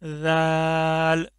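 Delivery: राल said light and thin, held very slowly. Shown as a demonstration. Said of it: "इसको भी पतला करके पढ़ना है".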